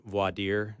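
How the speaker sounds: voir dire said as vwah-DEER; French pronunciation. Shown as a demonstration. Host explains the French word, voir dire as vwah-DEER. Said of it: "which is a French word".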